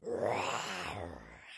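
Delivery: deep, drawn-out animal roar; big low growling roar; lion's roar. A narrator's reading. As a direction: roar like an angry lion. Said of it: "he roared".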